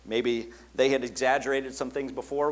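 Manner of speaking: ordinary talk, nothing marked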